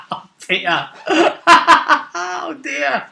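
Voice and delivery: high, wheezing laugh through the words; giggling fit, pitch way up; helpless laughter. Real, not acted. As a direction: crack up while saying it. Cracking up over a character death.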